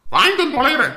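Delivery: very loud, high, grudging retort; exasperated shout. Acted. Husband grudgingly agreeing to shop, exasperated shout. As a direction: agree as if cursing the errand.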